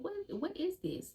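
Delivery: very high-pitched retold disbelief; stretched, high baffled exclamation; bewildered alarm. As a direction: squeal the question in mock dismay. Reliving alarm at sudden sickness.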